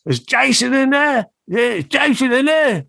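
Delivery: Bon calling out. Retold or imitated. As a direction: call through the closed door.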